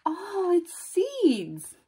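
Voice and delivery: gasp, then high, slow wonder; high-pitched storytime amazement; amazed discovery. Acted. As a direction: stretch out the delighted reveal. Gasping at what is revealed.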